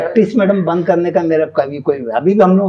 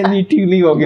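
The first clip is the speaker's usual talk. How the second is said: breaking into laughter mid-quote; laughing through a quote; 5 semitones higher, 4 dB louder than usual. Real, not acted. Doctor laughing as he retells his patient's reply, amused.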